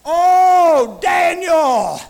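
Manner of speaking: lamenting cry. Acted. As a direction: cry out as the anguished king. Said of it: "he came in cry and lamentation".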